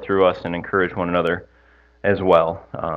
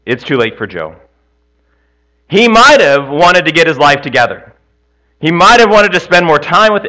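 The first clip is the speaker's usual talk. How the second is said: rising fire-and-brimstone shout, much higher and louder; preacher yelling; 7 semitones higher, 7 dB louder than usual. Acted. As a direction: build into a hellfire preacher's yell. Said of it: "I'm trying to yell at you guys and I'm not doing a very good job".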